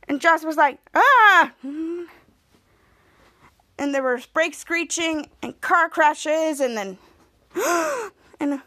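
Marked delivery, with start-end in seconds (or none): 1.0-1.5 loud, high imitated scream; quick mock scream of alarm; startled scream. Jasper startled by violent TV show. 7.5-8.1 high, sharp acted gasp; sudden gasp as a sound effect; imitated gasp. Acting out sounds from the show.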